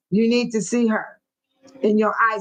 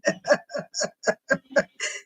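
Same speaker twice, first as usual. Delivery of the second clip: breathy giggle; 5 dB quieter than usual. Real, not acted. Laughing at herself on stream.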